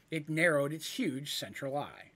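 usual speaking voice

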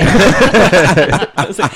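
slow, laughing through the words. Real, not acted. Telling friends the Swedish story, laughing throughout. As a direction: crack up while speaking.

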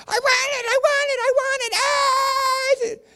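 very high, wailing tantrum voice; imitated toddler scream. Comic imitating a kid in the grocery store, screaming. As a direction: scream it like a mock three-year-old in meltdown. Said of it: "this kid, three years old, screaming".

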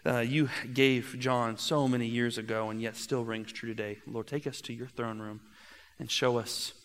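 soft, low opening prayer. Acted. Pastor praying softly before the sermon, hushed prayer. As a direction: pray quietly over the congregation.

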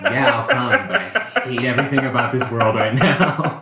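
high, loud laughing resignation; laughing grudging yes. Retold or imitated. Comic accepts the gig, laughing high and loud. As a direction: accept through gritted laughter.

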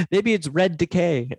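half-hearted hopeful self-deception, drawn out; lightly voiced denial. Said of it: "keep drilling away and going".

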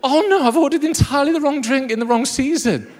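high-pitched, hammed-up realisation of a blunder; mock dismay. Flustered customer who ordered the wrong drink, mock dismay. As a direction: wail it in mock embarrassment. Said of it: "I was like".

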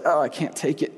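mock child complaining; whiny kid. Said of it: "He's just like".

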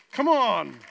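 very loud, high exultant call; rousing shout. Real, not acted. Preacher to congregation after God has come, rousing shout. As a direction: shout it out to rally the room.

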